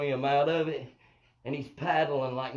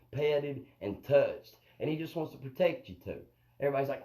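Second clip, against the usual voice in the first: ordinary talk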